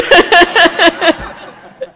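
loud high real laughter; hearty laugh. Real, not acted. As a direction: burst out laughing at your own joke. Laughs after her joke.

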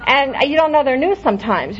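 ordinary talk

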